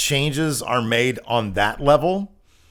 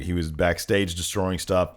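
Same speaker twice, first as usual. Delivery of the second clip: ordinary talk, nothing marked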